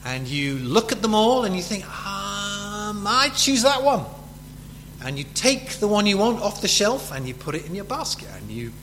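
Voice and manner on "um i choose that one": a drawn-out higher-pitched "um", then a decision; lightly voiced shopper making up their mind; indecisive shopper. Shopper picking crisps off the shelf, lightly acted deliberation.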